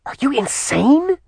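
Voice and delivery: high, sharp hissed rebuke. Acted. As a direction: hiss it in outraged disbelief. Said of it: "she hissed".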